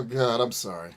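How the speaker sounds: ordinary delivery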